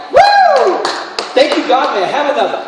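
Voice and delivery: very high whoop, then loud giddy thanks; mock celebration. Preacher mocking shallow joy, hammed-up loud cheer. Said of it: "It's not like".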